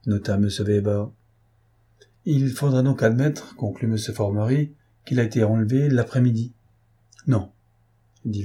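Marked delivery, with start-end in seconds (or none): none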